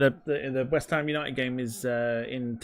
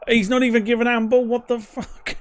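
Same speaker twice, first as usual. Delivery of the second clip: higher and louder outrage; outraged protest; 9 semitones higher, 4 dB louder than usual. Real, not acted. Fan angry that handball wasn't given, outraged shouting. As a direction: rail at the referee in disbelief.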